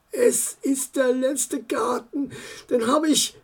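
softly talking himself up, near tears; quiet pep talk. Acted. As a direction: encourage yourself under your breath. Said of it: "sprach er sich Mut zu".

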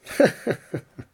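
short acted chuckle; brief character laugh; uncle's chuckle. A narrator's reading. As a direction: chuckle teasingly after withholding an answer. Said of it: "lachte sein Onkel".

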